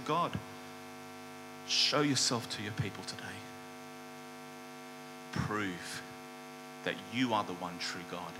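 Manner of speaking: low, hushed, heartfelt plea; simple prayer. Retold or imitated. Elijah praying to God before the people, quietly and simply. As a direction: pray softly and low, with pauses. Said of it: "he just said a simple prayer".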